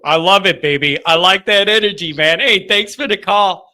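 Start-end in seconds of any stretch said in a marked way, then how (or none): none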